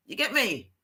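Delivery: usual speaking voice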